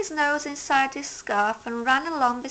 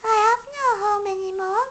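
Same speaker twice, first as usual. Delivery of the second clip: sad hedgehog; 9 semitones higher, 4 dB louder, faster than usual. A narrator's reading. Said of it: "said Little Hedgehog sadly".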